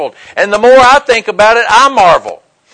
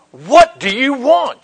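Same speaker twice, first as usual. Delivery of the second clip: higher, drawn-out exasperated question; mock annoyed demand; 6 semitones higher, slower than usual. Brig guards answer a noisy drunk Marine, mock annoyance. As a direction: lightly imitate a fed-up guard.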